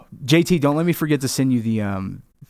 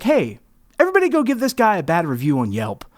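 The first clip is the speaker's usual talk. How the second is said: high-pitched call to the crowd, settling down; rally everyone like a gleeful troublemaker; mock rallying call; 5 semitones higher than usual. Rallying everyone online against the owner.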